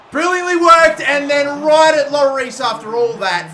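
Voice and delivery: high, loud burst of disbelief; excited yell. Real, not acted. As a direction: shout it up high as the chance goes begging. Great chance saved by keeper.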